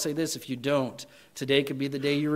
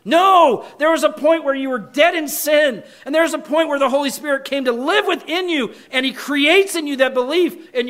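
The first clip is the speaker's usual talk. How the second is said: preaching shout; 8 semitones higher, 6 dB louder than usual. Real, not acted. Preacher rebukes shallow testimonies.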